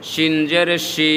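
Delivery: 'শি' with a broad sh; thick SH. Shown as a demonstration. Teacher compares similar letters, 'শি' with a thick sh. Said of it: "মোটা করে".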